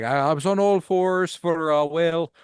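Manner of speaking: high put-on thick Irish accent; chirpy thick Irish brogue. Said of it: "she's doing, like, a really thick Irish accent".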